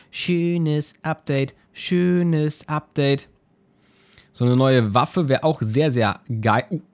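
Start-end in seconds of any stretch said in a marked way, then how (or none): 0.1-3.3 very slow, drawn-out words; savouring. Real, not acted. Gamer admiring his new upgrade, savouring it very slowly. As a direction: stretch each word out with relish.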